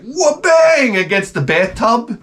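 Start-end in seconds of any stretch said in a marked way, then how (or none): 0.0-1.0 door bang. Retold or imitated. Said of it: "the door goes like".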